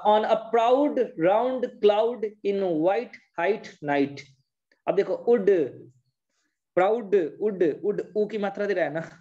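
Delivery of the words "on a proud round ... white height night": slow model reading. Shown as a demonstration. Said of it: "मैंने बोला".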